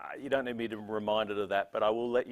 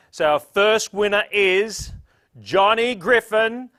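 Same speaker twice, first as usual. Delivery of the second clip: high, loud, stretched-out reveal; winner announcement; 8 semitones higher, 11 dB louder than usual. Real, not acted. Emcee naming the first gift-card winner, loud and thrilled. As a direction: call the winner like a game-show host.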